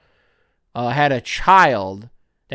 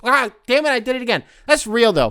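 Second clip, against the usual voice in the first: sudden higher, louder exclamation; exasperated outburst; 9 semitones higher, 4 dB louder than usual. Real, not acted. Catching himself mocking the French, higher outburst. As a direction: caught himself again, burst out.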